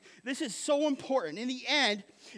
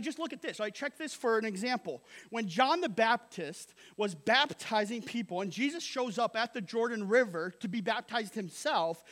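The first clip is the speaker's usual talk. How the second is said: ordinary talk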